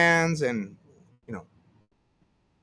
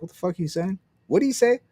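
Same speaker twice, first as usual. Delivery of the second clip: confused listener; 6 semitones higher, faster than usual. Retold or imitated. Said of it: "people are like".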